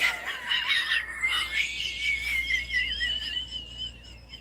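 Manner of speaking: shrieking wheezy laugh, can't stop; helpless high laughter. Real, not acted. Vlogger cracking up alone over a favourite film joke, shrieking laughter. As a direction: laugh until you can barely breathe.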